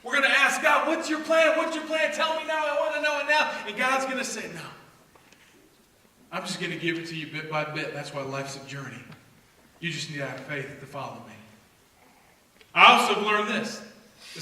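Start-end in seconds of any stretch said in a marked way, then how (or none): 0.9-3.5 high, eager demanding plea; impatient asker. Acted. Believer pleading with God, high and impatient. 4.5-11.3 lower, softer, patient voice; God's calm reply. Acted. God answering the impatient believer, low and calm.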